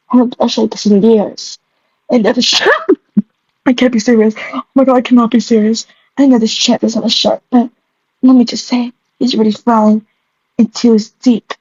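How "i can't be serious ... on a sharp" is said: ordinary delivery, nothing marked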